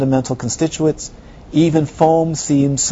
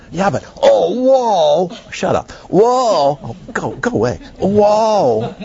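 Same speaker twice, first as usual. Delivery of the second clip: high whoas that brush the teacher off; imitating dismissive visitor; 6 semitones higher, slower than usual. Visitors ignore the Lama, dismissive imitated awe. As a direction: wave him away, lost in the crystals. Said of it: "being completely ignored".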